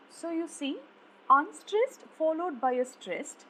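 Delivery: ordinary talk, nothing marked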